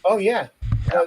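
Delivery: ordinary talk, nothing marked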